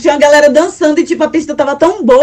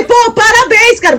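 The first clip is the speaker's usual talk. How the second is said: enthusiastic congratulations; 8 semitones higher than usual. Retold or imitated. Congratulating the boy, retold.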